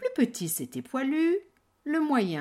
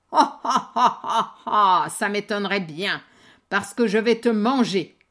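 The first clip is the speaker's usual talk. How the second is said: loud mocking troll laugh; 8 dB louder than usual. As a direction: cackle like a hungry troll.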